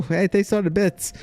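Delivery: high, sugary mock thanks; sarcastic thanks. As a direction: thank them with fake cheer. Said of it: "I still think I caught the T T S".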